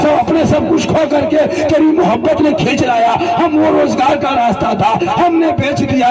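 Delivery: crying prayer. Retold or imitated. Poor tanga driver weeping and crying out at the shrine. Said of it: "रोने लगा".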